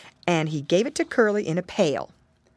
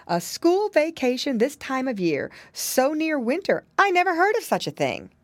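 astonished mother, pitch climbing higher; astonished cry; 8 semitones higher than usual. A narrator's reading. Piggy mother never heard of such a thing, astonished. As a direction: exclaim in rising disbelief. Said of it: "cried Missus Twisty Tail".

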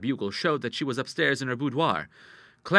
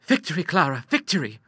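excited, higher-pitched exclamation; triumphant cry; 6 semitones higher, 5 dB louder than usual. Acted. Girl celebrating to her sister, high triumphant cry. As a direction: crow it in triumph. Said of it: "cried Ida".

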